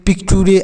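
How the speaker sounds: "पिक्टूरे" as pik-too-ray; beginner's misreading. Shown as a demonstration. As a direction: sound out "पिक्टूरे" with a hard T. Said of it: "ऐसे पढ़ेंगे". ("पिक्टूरे" में चर की जगह टूरे)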